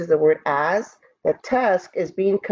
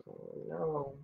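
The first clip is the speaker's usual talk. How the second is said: plain reading voice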